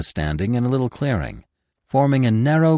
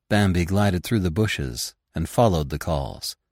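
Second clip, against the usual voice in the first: plain reading voice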